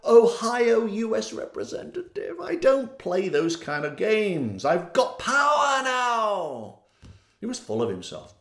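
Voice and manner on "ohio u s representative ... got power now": cocky Jim Jordan. Retold or imitated. Mocking Jim Jordan bragging to an unseen voice. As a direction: puff up and brag, laughing. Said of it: "He didn't want to know".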